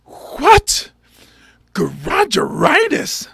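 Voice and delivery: outraged baffled echo; hammed-up shock; intense disbelief. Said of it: "he was like".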